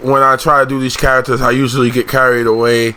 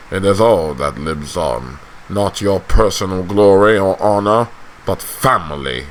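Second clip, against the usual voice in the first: slow, deliberate lordly lecture; stern patriarch; 4 semitones lower, slower than usual. Acted. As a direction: impress family duty on your child, sinking low.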